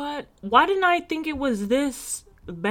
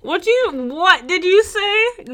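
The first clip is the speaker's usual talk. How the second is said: high, loud, drawn-out challenge; hammed-up offended patriot; mock outrage; 11 semitones higher, 6 dB louder, much slower than usual. Co-host confronting British critics, mock outrage.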